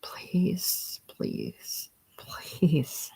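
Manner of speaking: soft, whispery whimper; hushed, desperate begging. A narrator's reading. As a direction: plead weakly, nearly voiceless.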